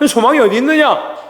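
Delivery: 있느냐 ending in a falling tone, voice lifted high; preacher's question pitched well above his speaking voice, falling on 있느냐. Shown as a demonstration. Pastor repeats the rhetorical question.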